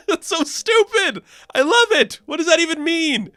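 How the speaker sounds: high, squealing through laughter; real giggly incredulity; amused disbelief. Real, not acted. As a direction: crack up at something gloriously silly. Reviewer cracks up at name origin.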